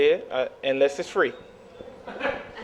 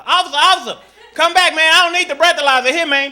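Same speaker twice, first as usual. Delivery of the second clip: high, loud, pleading shout; urgent calling out; 10 semitones higher, 9 dB louder than usual. Acted. Nervous driver calling the officer back, urgent shout. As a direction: call him back urgently at full voice.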